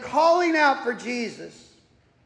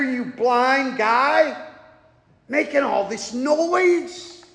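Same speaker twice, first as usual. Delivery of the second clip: normal talking voice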